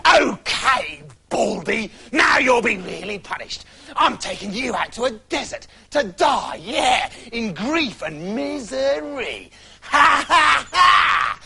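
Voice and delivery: high, shouting witch, cackling at the end; wild witch. Acted. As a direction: gloat and yell at her. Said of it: "The witch was wild with anger".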